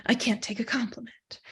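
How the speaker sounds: a dismissive little whisper; whispered retort. Retold or imitated. Lecturer quoting someone brushing off her anger, whispered. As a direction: breathe it out under your breath.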